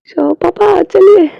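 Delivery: high, loud call to papa; child calling out. Acted. Child calls his father to come bathe, loud and high. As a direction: shout for papa to come along.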